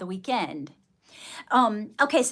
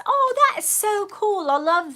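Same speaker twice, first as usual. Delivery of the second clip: excited; 10 semitones higher, 6 dB louder than usual. Real, not acted. Delighted reaction to viewer comment.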